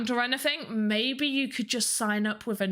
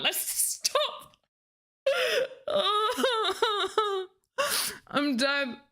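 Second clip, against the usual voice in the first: high breathless laugh breaking through; collapsing into giggles mid-bit; 7 semitones higher, much slower than usual. Real, not acted. Streamer cracking up after her own hype act, laughing. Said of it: "I can't do that again".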